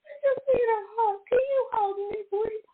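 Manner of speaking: high, squeaky mock-weepy begging; tearful pleading. Retelling her plea to her best friend, tearful and high. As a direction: beg for comfort in a tiny crying voice.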